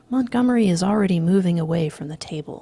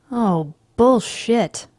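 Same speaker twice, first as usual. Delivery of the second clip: slow, louder groan of disbelief; scornful moan; 5 semitones higher, 8 dB louder, much slower than usual. Acted. The friends groan at Van Patten's claim, slow and scornful. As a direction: drag out "Oh, bullshit" in mocking disbelief. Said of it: "we all moan".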